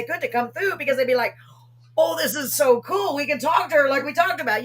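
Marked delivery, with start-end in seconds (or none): 2.0-4.6 excited. Retold or imitated. Enthusing as the departed relatives.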